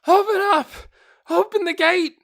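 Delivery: high, loud panicked screaming. A narrator's reading. Fleeing patrollers beg the gate guards, panicked screams. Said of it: "their panicked screams".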